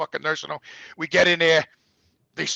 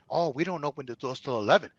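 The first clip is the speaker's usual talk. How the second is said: nurse voice; 7 semitones lower, 8 dB quieter than usual. Retold or imitated. Nurse voiced, low and soft.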